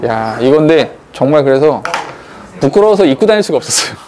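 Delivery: ordinary talk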